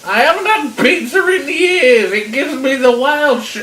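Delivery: high, loud granny shouting through a door; crotchety granny turning a caller away; suspicious old granny. Acted. Granny to the caller at her door, loud and dismissive. Grandma rebuffing pizza caller.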